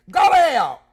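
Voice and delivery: loud high mock retort; quoting Jerry Lee. Streamer imitates a friend's catchphrase, loud mock. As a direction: toss it out like Jerry Lee would. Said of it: "Like my girl Jerry Lee and say".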